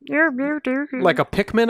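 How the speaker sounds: tiny creature squeak. Shown as a demonstration. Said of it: "it sounded like a".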